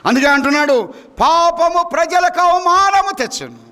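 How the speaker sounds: high, ringing preaching voice; scripture proclamation. Real, not acted. Proclaims the verse.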